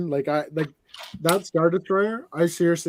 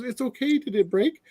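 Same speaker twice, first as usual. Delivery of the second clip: reassuring mother; 8 semitones higher than usual. Retold or imitated. Mother checking on daughter's dropped toy, imitated reassurance. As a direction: soothe the child, then ask with a lift. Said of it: "And she goes".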